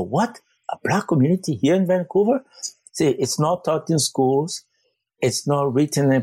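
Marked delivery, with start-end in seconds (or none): 0.0-2.4 higher-pitched, incredulous question; shocked disbelief. Retold or imitated. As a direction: imitate people taken aback, pitch lifted. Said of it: "they're shocked".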